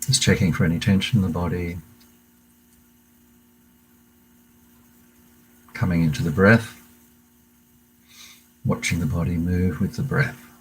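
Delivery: calm meditation guide voice, unhurried pauses; guided meditation. Acted. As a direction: lead the class gently into the breath. Leads a breathing meditation.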